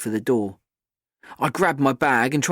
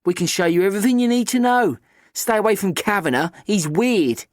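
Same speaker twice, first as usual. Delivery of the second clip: put-on leering boys voice; 8 semitones higher, 4 dB louder than usual. A narrator's reading.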